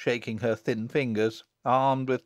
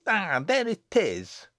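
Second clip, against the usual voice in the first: high, drawn-out sharp retort; sharp cry; 8 semitones higher, 3 dB louder, slower than usual. A narrator's reading. Jennet answering a slight on her deformity, sharply. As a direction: pounce on the remark, slowly and high. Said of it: "cried Jennet sharply".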